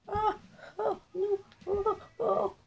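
small high worried bird; high cartoon voice. Voicing a bird in distress.

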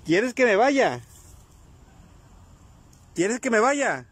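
urgent calls to a spirit. Real, not acted. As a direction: demand an answer from the ghost. Questioning the spirit, startled.

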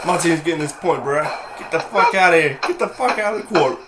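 loud cocky trash-talker. Retold or imitated. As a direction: bark it like a smug opponent. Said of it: "He's like".

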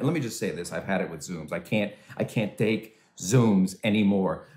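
normal talking voice

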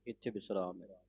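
usual speaking voice

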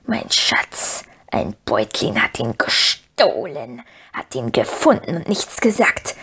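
creepy whispered creature voice; Gollum voice. A narrator's reading. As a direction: hiss it like Gollum, torn between two selves. Gollum arguing with himself.

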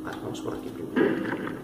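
ordinary talk

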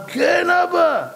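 high, drawn-out shouted answer; acted cry. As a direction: cry out to father in hammed-up anguish. Esau answers his shocked father. (זעקה גבוהה ומשוחקת)

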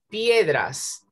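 normal talking voice

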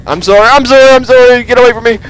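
high, loud frantic pleading; panicked pleading. Real, not acted. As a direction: beg it in panic. Fleeing monster.

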